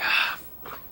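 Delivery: soft unvoiced grumble; whispered curse. Player cursing the failed roll, soft whisper. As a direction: breathe the curse under your breath.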